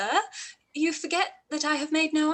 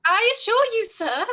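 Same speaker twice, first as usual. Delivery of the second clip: high, louder indignant burst; indignant retort; 8 semitones higher, 6 dB louder than usual. Acted. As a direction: cut him off in exasperation. Elizabeth indignant at Collins.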